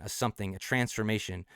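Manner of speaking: ordinary delivery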